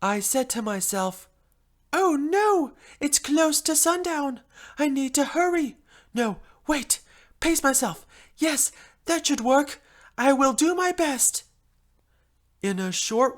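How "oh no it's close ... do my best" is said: higher, louder flustered self-talk; mildly theatrical panic; panicked. A narrator's reading. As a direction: fluster as the deadline closes in. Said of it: "looked at the clock in horror and panicked".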